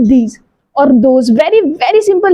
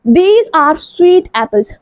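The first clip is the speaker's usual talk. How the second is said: very slow and clear; slow model sentence; much slower than usual. Shown as a demonstration. Teacher modeling for pupils, very slow. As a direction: say it slowly for the children. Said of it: "model sentence".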